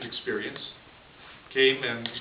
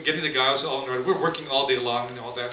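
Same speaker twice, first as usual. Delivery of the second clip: ordinary delivery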